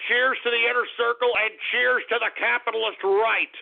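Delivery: ordinary talk, nothing marked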